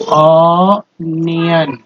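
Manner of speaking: ऑनियन drawn out very slowly, each syllable clear; slow model word. Shown as a demonstration. Teacher modelling ऑनियन slowly for students. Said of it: "तो देखिए यहाँ पर बोलते हैं".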